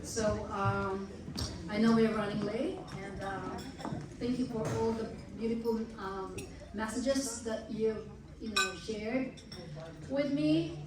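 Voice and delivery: soft, teary voice after crying. Real, not acted. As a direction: speak quietly, still choked up. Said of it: "I'm been crying all this time".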